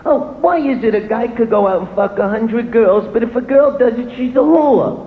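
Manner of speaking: woman complaining about a double standard; mimicking women. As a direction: whine it like a complaining girlfriend. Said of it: "you always hear 'em with their little conversations".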